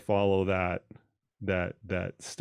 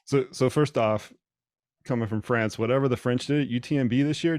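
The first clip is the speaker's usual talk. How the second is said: ordinary talk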